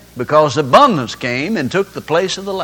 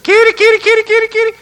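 very high, rapid kitty call; calling cats; 4 dB louder, faster than usual. Acted. Man wishing for his dog, calling the cat instead.

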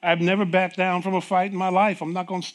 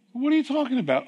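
puzzled; 9 semitones higher, faster than usual. Retold or imitated. Confused reply to doctor.

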